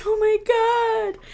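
high, slow, giggling Oh my God; laughing quote. Retold or imitated. Retelling a friend's reaction, high and slow with laughter. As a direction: stretch it out through laughter. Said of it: "she's like".

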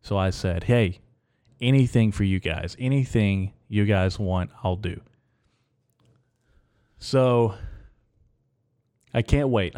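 usual speaking voice

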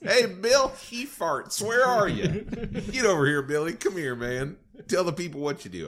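calling out high and loud, hammed-up; hammed-up high call to Billy; mock boss calling out. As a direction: bellow for Bill across the room in jest. Mock president calling Evarts over.

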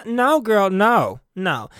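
ordinary talk, nothing marked

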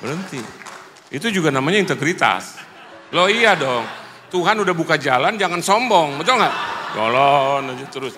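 emphatic, high-pitched pulpit push; forceful preaching. Real, not acted. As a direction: drive it home to the congregation.

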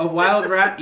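A wild rat, loud with wild hit hard; correcting them: wild rat, stress on wild. Shown as a demonstration. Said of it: "And teach you kung fu?".

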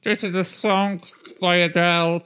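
a hint of tinny karaoke microphone; mock a cheap karaoke mic announcement. Mocking the crap karaoke microphone, lightly tinny voice. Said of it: "one of those microphones where they're all".

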